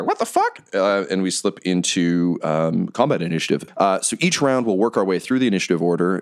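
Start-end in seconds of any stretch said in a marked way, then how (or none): 0.0-0.6 high squeaky goblin. Acted. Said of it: "the goblins kinda mutter".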